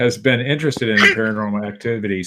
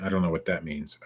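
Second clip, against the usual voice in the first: ordinary talk, nothing marked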